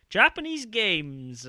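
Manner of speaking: loud, slow, high excited gasp. Retold or imitated. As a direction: blurt it out thrilled, drawn out. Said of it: "I was just like".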